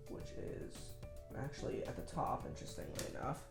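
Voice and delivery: under-the-breath whisper while searching; whispered aside. Real, not acted. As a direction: whisper it to yourself. Muttered aside while searching deck.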